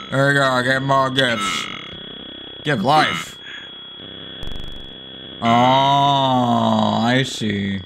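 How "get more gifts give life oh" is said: ordinary talk, nothing marked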